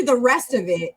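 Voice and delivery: normal talking voice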